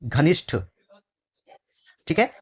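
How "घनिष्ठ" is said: घनिष्ठ said clearly, ष्ठ with a crisp retroflex ending; correct pronunciation. Shown as a demonstration. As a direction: spell out घनिष्ठ carefully, sound by sound. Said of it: "क्या लगेगा प्यारे बच्चे". (घनिष्ठ को साफ़ और शुद्ध उच्चारण से बोलें)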